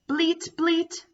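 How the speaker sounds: high playful bleating; cheerful goat sound for kids. Shown as a demonstration. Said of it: "and says".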